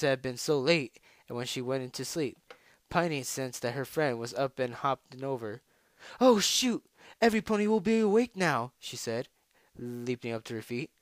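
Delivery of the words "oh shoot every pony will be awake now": higher, louder, rushed dismay; sudden high-pitched fluster; alarmed outburst. Acted. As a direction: blurt it out on waking late. Wakes late in a panic.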